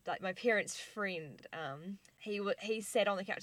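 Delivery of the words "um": ordinary talk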